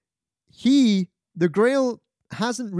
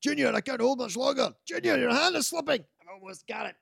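strained film hero's father; 9 semitones higher, faster than usual. Retold or imitated. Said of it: "while he's going for the grail".

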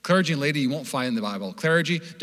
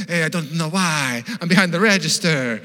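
slow, louder hammed-up Mediterranean accent; mock Greek-Italian accent; 4 semitones higher, 6 dB louder than usual. Restaurant worker explains himself to customer, hammed-up Greek-Italian accent. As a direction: put on a bad Greek accent that slips into Italian. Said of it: "I'm giving him an accent".

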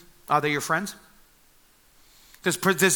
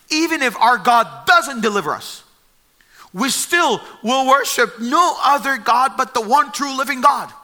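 defiant vow; 5 semitones higher, 4 dB louder than usual. Retold or imitated. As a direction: plant your feet and refuse.

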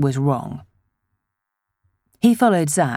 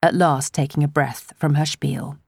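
ordinary delivery

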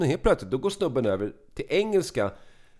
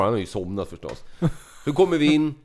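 usual speaking voice

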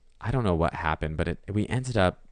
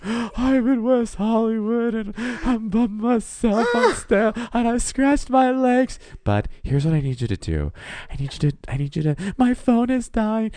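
crying; 7 dB louder than usual. Retold or imitated. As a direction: sob it out, drawn and high. Drunk crying call to roommate.

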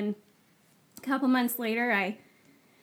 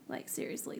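retold creepy whisper; 12 dB quieter than usual. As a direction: breathe it out as a secret. Tells friend house is haunted.

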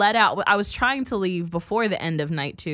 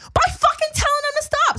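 high, loud retold scream; exasperated yell; 5 dB louder than usual. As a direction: yell it back in exasperation, reenacting the row. Retold screaming match with crossing guard.

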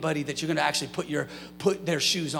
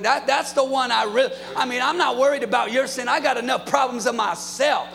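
raised preaching voice; fiery preaching; 7 semitones higher, 4 dB louder than usual. Acted. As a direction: preach it to yourself, full force.

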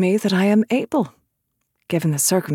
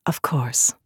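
whispered; 7 semitones lower, 6 dB quieter than usual. Acted. Callista answers quietly.

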